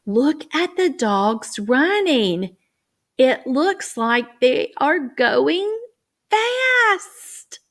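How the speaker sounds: put-on bright storytelling to little kids, fast pops up; teacher reading voice. Shown as a demonstration. Teacher to young readers, animated high voice. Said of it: "Then I say".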